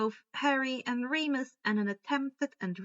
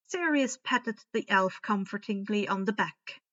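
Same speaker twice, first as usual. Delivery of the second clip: ordinary delivery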